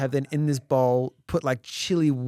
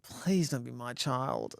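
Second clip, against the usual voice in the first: slow, softer inward plea, retold; hushed dread; 3 semitones lower, 9 dB quieter, slower than usual. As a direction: quietly beg it isn't yours. Dreading whose child screams.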